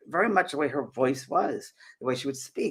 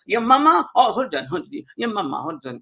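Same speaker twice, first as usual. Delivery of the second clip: put-on French accent; 7 semitones higher, 5 dB louder than usual. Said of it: "And he goes".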